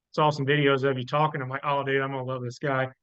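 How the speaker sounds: ordinary talk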